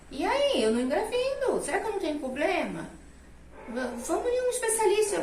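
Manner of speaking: wife urging her husband to see a specialist, pleading. Retold or imitated. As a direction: plead with him, half laughing.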